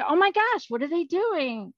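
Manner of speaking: high, loud astonished exclamation; alarmed onlooker's outcry; shocked gasping. Acted. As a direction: react aghast, pitch leaping up. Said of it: "they're all gasping".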